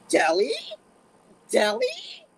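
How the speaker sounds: loud, high squeal, climbing on the repeat; excited surprise. Retold or imitated. Angry dad hears of the deli, suddenly thrilled.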